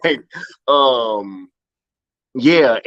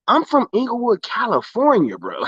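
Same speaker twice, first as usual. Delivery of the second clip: high, fired-up protest; defending his hometown to doubters; indignant insistence; 11 semitones higher than usual. Retold or imitated. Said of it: "I'm like".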